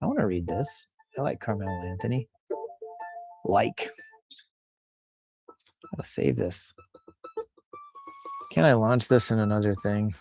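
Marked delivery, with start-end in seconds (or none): none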